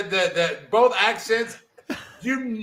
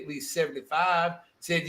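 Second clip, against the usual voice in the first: ordinary talk, nothing marked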